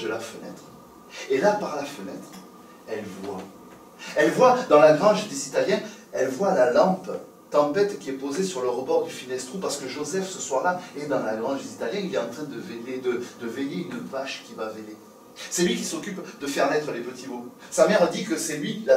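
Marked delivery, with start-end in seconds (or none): none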